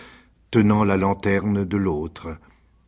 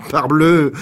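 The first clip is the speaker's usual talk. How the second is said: louder, higher cynical bark; 8 semitones higher, 9 dB louder than usual. A narrator's reading. Rocambole answers his victim, loud and cynical. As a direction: toss it off with cold glee. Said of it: "d'un ton cynique".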